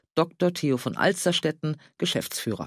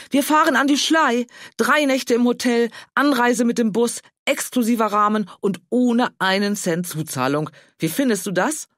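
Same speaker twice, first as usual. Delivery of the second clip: much higher and louder, gushing; elated; 8 semitones higher, 5 dB louder than usual. Acted. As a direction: rattle off the prize in delight. (begeistert, viel höher und lauter)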